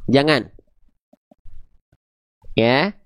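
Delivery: usual speaking voice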